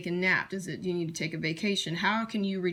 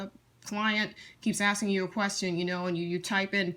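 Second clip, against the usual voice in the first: ordinary talk, nothing marked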